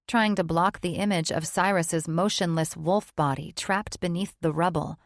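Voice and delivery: ordinary delivery, nothing marked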